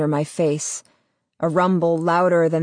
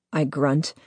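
ordinary delivery, nothing marked

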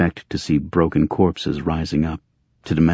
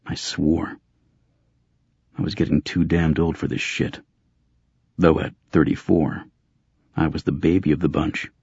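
plain reading voice

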